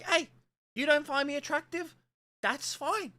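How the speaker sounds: high-pitched, put-on nonchalance; mock unbothered reply. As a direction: pitch it way up, playfully shrugging off rejection. Acts out reply to rejecting women.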